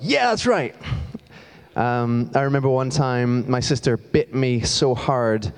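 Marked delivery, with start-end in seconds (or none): 0.0-0.8 much higher, eager mock cheer; gleeful youngest sibling. Youngest siblings backing the tattler, high, gleeful mock cheer. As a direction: squeak it out like a smug little brother. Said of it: "all the youngest ones are like".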